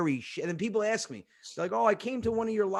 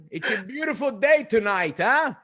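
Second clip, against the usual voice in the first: higher, louder chatty call; imitating dad's car-to-car greeting; embarrassing father; 5 semitones higher, 6 dB louder than usual. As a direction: holler small talk as an overfriendly dad. Father calling to girls in car.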